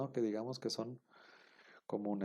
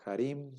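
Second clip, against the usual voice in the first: harim with the stress on the final "im"; stress on last syllable; 3 semitones higher than usual. Shown as a demonstration. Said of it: "el acento iría en la última sílaba".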